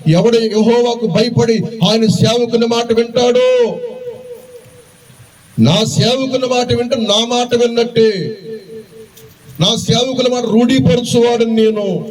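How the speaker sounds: preaching at full force. Acted. Preacher to his congregation, lifted preaching voice. Preacher voicing God, then scripture.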